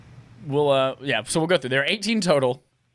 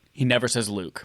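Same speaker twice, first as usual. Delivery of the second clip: normal talking voice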